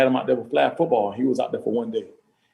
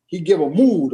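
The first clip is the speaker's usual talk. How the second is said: ordinary talk, nothing marked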